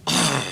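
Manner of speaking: short acted-out biting snarl; chomping growl. Shown as a demonstration. Man re-enacting the bite, mock growl. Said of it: "I just went".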